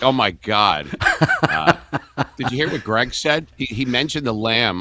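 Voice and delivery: high, loud mock outrage with a laugh; playing a listener scandalised by a nursery rhyme; mock shocked listener. Friend hearing Mary Had a Little Lamb, mock-shocked squeal. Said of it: "they'll be like".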